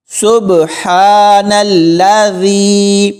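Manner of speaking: slow, drawn-out سبحان الذی with long held vowels; tajweed recitation. Shown as a demonstration. Teacher showing learners the travel dua, slow tajweed recitation. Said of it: "تجوید کے ساتھ پڑھنا سیکھیں گے".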